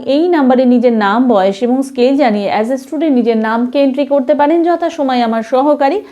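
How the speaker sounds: ordinary talk, nothing marked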